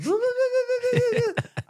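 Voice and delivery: high, loud burbling mock yell; imitated wild noise. Player imitating teammate grabbing his neck, wild high noise. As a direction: burble a long high noise, mimicking him. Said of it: "he's got hold of my neck and he's going".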